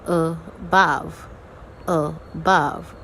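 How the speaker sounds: "above" with a weak first syllable, then a stressed -bove; no pressure on the a of "above", push the second syllable; teacher separating weak and strong syllables in "above"; unstressed syllable demo. Shown as a demonstration. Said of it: "don't apply any pressure while saying your unstressed syllable".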